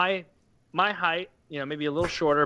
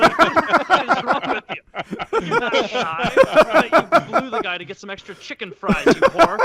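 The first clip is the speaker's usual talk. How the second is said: much higher and louder, a hammed-up scolding rant; mock yelling rant; 9 semitones higher, 8 dB louder than usual. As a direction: yell at her in mock outrage. Ranting at the poster.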